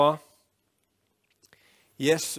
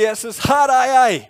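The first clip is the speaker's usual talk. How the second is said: loud, high, climactic shout; crying out to Jesus; 12 semitones higher, 16 dB louder than usual. Acted. Believer presenting themselves to the returning Jesus, loud cry. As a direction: shout it out as the sermon's peak. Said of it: "og så sier du".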